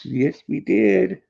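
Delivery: very high, slow sing-song coo. Real, not acted. Owner croons to Luna about their walk, very high and slow.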